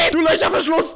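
high, screeched insult; shouted insult. Pretend.

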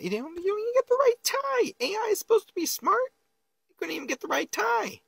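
mock squeaky voice. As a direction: scold the AI in a silly falsetto. Mocking the AI video.

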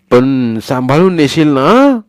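a granny fretting over the money; worried grandmother. Acted. Grandmother asking Sonya to carry it safely, worried.

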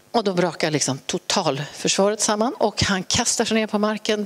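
normal talking voice

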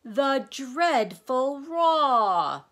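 very slow, high, loud wail; anguished cry. A narrator's reading. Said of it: "cried the anguished din".

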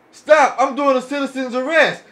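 ordinary talk

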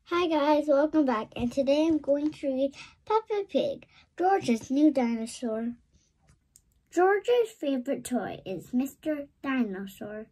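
plain reading voice

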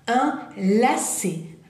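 "Un lacet" stretched syllable by syllable; slow French model. Shown as a demonstration. Teacher repeating for learners, very slow. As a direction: say "Un lacet" very slowly.

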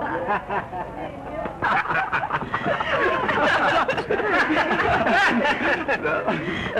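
high, long tipsy cackle; long drunken laugh. Acted. Drunk partygoer bursting into a long high laugh.

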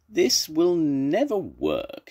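slow, high lament; sighing. Acted. Zebra gives up on Tiger's cure, sighing. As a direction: draw it out as a mild sigh. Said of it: "he sighed".

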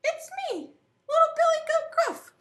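very high, squeaky little goat. Acted. Little goat answering the troll, high-pitched. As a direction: pipe up in a tiny high voice.

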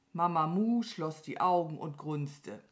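ordinary delivery, nothing marked

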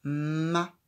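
"Ma." said loud and distinct; teacher confirming with a loud "Ma."; confirming syllable. Shown as a demonstration. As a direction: confirm "Ma." clearly. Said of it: "Elle est là".

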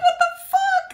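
very high squeaky disbelief; mock bewilderment. Customer second guessing themselves, squeaky bewilderment. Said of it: "They're gonna be like".

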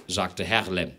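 usual speaking voice

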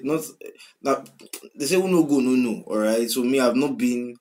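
normal talking voice

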